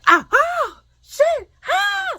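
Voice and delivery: high, loud shrieks of fright; startled scream. Retold or imitated. Woman ambushed at her own door, startled screaming. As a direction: scream as if someone jumped out at you. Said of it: "start screaming".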